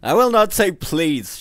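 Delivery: loud, high defiant shout. A narrator's reading. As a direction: shout the refusal. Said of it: "shouted Hans".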